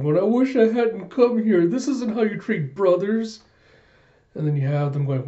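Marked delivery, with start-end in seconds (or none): 0.2-3.4 high-pitched pretend wail; hammed-up whiny lament; mock bawling. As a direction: act out a tearful complaint without real sobs. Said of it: "just bawling his eyes out".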